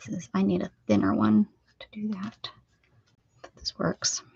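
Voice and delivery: soft unvoiced whisper. Real, not acted. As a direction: whisper it under your breath. Whispering while drawing.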